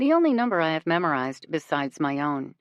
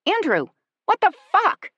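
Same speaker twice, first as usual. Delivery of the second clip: much higher and louder, shrill scream; 14 semitones higher, 7 dB louder than usual. A narrator's reading. To the cheating boyfriend, a shrill furious scream. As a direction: scream it in fury. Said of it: "I repeat shrilly".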